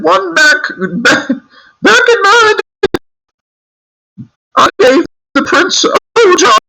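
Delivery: very high, hammed-up British granny voice; British grandma. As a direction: reminisce as a falsetto British grandmother. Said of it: "She said".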